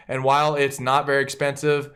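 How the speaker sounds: ordinary delivery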